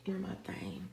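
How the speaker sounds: breathy whispered aside to himself. Real, not acted. Searching for his marker, he whispers to himself. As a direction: whisper it while hunting for something. Muttering off to the side.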